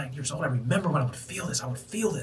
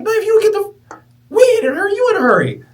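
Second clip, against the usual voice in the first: high, loud, strained comeback; loud ant voice; 14 dB louder than usual. Retold or imitated. The ants talk back to the man, high loud voice. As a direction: shout it back as the indignant ant.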